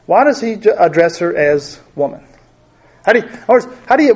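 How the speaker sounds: normal talking voice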